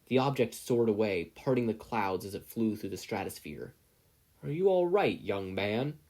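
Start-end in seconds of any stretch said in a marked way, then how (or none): 4.4-6.0 a hint of a concerned older man; a stranger helping a shocked teen up, lightly voiced; kindly stranger. A narrator's reading. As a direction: gently check on the fallen boy. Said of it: "the stranger asked".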